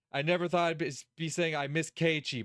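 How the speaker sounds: ordinary talk, nothing marked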